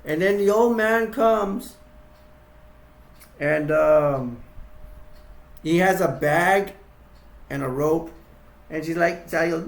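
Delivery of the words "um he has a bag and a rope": usual speaking voice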